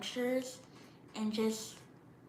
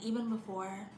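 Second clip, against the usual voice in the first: ordinary talk, nothing marked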